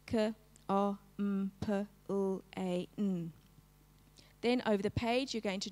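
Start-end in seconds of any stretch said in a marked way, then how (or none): 0.1-3.3 "K, O, M, P, L, A, N" as separate phonemes, kuh, o, mmm, puh, lll, ay, nnn; sounded out. Shown as a demonstration. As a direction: segment "K, O, M, P, L, A, N" sound by sound, not letter names. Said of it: "do it again on their own".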